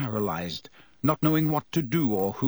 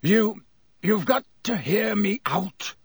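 croaked; 8 semitones higher, 3 dB louder than usual. A narrator's reading. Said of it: "he croaked".

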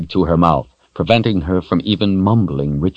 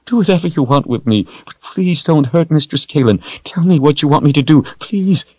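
higher, fast, desperate; frantic pleading; 9 semitones higher than usual. Acted. Richard frantically begging Darken Rahl, high and fast. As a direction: rush the plea out.

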